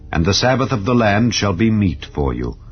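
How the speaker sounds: ordinary delivery, nothing marked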